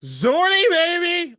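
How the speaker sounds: put-on high, loud gloating "Zorny, baby"; gleeful mock cheer. Friend gloating over the phone, high and loud. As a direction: crow it high like a taunting friend. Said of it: "He's like".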